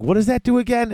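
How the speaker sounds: curious newbie. Retold or imitated. Voicing a curious new player.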